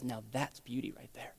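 soft, low, near-whispered reassurance; tender answer. Acted. God answering a broken believer, tender hushed reassurance. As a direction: hush it right down, tenderly reassuring.

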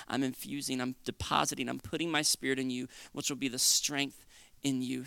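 low, softened voice of promise; gentle assurance. Acted. Jesus promising his spirit to the believer, gently assuring.

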